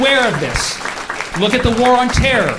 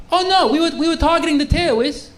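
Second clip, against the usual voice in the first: higher-pitched mock toddler whine; toddler-like excuse; 7 semitones higher than usual. Israel excusing itself like a toddler, childish protest. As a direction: make excuses like a little kid. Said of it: "say it like a toddler".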